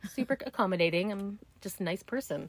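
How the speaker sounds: ordinary delivery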